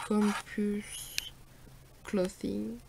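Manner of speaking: English words in a French accent; unpractised French-accented English. Real, not acted. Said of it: "je suis pas très forte en anglais".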